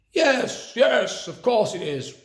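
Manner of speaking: high, sing-song sarcasm; sarcastic. A narrator's reading. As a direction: humor him with mock agreement. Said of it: "replied Miss Swine sarcastically".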